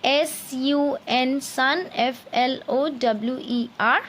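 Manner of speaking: very slow letter-by-letter spelling of sunflower; spelled out slowly. Shown as a demonstration. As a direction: dictate each letter with pauses. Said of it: "heading of proper noun".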